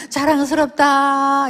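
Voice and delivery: slow, dragged-out grudging words; forced reluctant praise. Retold or imitated. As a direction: say it only because you're poked. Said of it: "콱콱 찔르면". (억지로 느리게 끌며 말하는 아빠)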